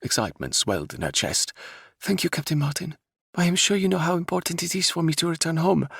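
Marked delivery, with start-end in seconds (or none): 2.0-5.9 raised, earnest princess voice; grateful princess voice. Acted. Princess thanks Captain Martin, earnest and grateful. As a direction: thank him like a relieved young royal.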